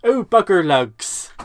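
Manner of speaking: slow, loud broad West Country drawl; hammed-up rural West Country accent. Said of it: "in a broad West Country accent".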